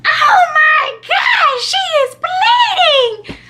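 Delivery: very high, slow, drawn-out mock wail; panicked shriek. Sister sees the girl bleeding, imitated panicked shriek. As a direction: imitate a horrified shriek, stretching each word.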